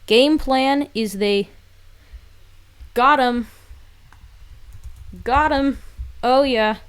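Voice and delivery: loud, high gloating cheer; excited. Real, not acted. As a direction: celebrate the knockout loudly. Celebrating a knockout.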